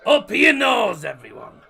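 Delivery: slow shouted toast to the viewers; loud toast. Real, not acted. Streamer shouting a toast to his viewers, slow and loud. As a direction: bellow it out as a cheers.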